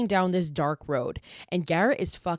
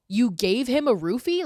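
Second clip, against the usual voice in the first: higher-pitched, incredulous question; 6 semitones higher, 4 dB louder than usual. Real, not acted. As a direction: question it in shocked disbelief. Outraged reaction to the retold plot.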